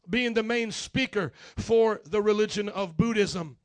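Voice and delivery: ordinary delivery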